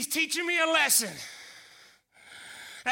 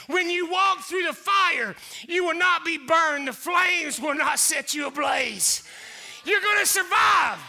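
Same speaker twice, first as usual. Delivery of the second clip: preaching at full force; 4 semitones higher than usual. Acted. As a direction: preach it at the top of your lungs. Sermon climax, shouting.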